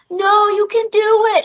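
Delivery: put-on high, childlike cheer of encouragement; little kids cheering. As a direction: squeak it out like a small fan rooting from the crowd. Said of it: "the kids are like".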